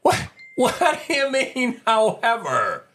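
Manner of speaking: high, slow, drawn-out disbelief; incredulous mock questioning. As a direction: stretch it out in sarcastic disbelief. Mocking incredulous repeat of "however.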